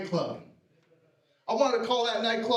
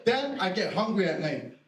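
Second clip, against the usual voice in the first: ordinary delivery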